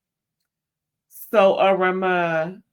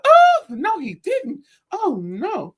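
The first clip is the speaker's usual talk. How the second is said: high, loud "oh no" that settles down; mock shocked reaction; 9 semitones higher, 6 dB louder, much slower than usual. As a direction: imitate a scandalized onlooker, hammed-up. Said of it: "you hear the lady go".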